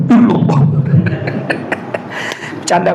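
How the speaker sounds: ordinary talk, nothing marked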